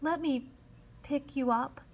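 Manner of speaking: said slowly. A narrator's reading. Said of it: "said very slowly".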